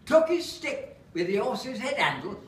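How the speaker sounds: lightly northern Lancashire lilt for a comic recitation; Lancashire accent. Acted. Performer reciting [name] monologue to audience, Lancashire accent. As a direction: recite it in broad Lancashire English. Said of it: "so I have to do it in an accent".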